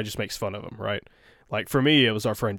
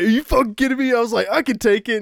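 outraged retort; 12 semitones higher, 7 dB louder than usual. Retold or imitated. Retelling a fight with Anna.

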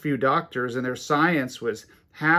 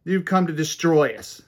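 lightly voiced demon, loud and raised at first; demon's challenge in a preacher's raised voice; demon outburst; 4 semitones higher, 6 dB louder than usual. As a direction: call it out as the demon, easing off at the end. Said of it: "The demon says".

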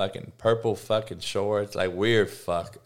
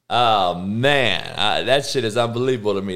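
ordinary talk, nothing marked

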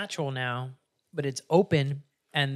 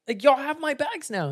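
panicked protest; 12 semitones higher than usual. Retold or imitated. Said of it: "she's like".

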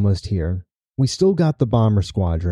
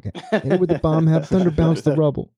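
savoring the line, a bit higher; quoting a favorite line with relish; relishing quote; 6 semitones higher than usual. Retold or imitated. GM quoting the colonel's order, savoring it. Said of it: "I like that".

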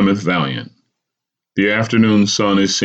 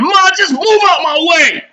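much higher, louder barked order; a son barking at his mother to move aside; 5 dB louder than usual. A narrator's reading. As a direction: bark it at your mother. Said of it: "Rogan barks again".

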